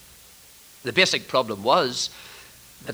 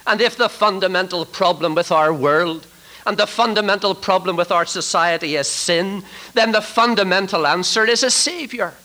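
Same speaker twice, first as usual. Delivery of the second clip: raised pitch, building to the final word; forceful preaching; 5 semitones higher, 3 dB louder than usual. Real, not acted. Preacher telling congregation the world needs a Savior, forceful. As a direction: drive the argument home from the pulpit.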